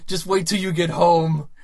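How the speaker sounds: loud, slow mock warning; angry threat. Imitating girlfriend warning prankster boyfriend, slow loud anger. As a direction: spell out the threat, hammed-up.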